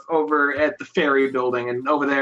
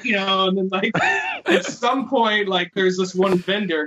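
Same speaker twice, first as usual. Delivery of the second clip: normal talking voice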